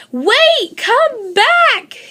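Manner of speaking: high, loud desperate yell; bears yelling after Goldie's truck. A narrator's reading. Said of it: "yelled the bears".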